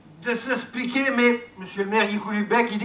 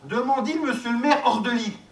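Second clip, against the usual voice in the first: ordinary delivery, nothing marked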